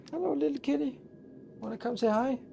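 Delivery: put-on high baby talk for a kitty; sweet high pet voice; cooing to a cat.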